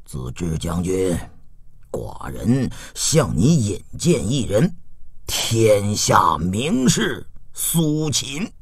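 low, measured sovereign voice; low, soft aged lord; old ruler. A narrator's reading. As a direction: introduce a guest with unhurried dignity. Aged duke introduces a guest.

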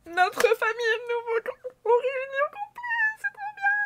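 choked up that the family is whole again; tearful joy. Real, not acted. Famille réunie, voix émue. (de plus en plus aiguë, émue aux larmes)

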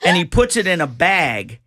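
ordinary talk, nothing marked

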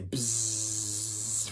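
long, steady low buzz; bee buzz. Shown as a demonstration. Animal warm-up, long bee buzz. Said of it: "What about a bee?".

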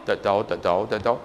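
low, soft repeated reply; small, hurried low mumble; meek denial. Retold or imitated. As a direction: back away from it quietly. Cowed reply to shouting clerk.

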